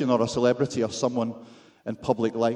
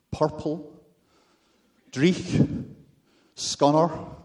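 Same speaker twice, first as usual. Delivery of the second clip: savoured Scottish words, pitched higher; Scottish accent; 4 semitones higher, much slower than usual. Shown as a demonstration. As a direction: relish each word in a Scottish accent, one by one. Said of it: "sound better in a Scottish accent".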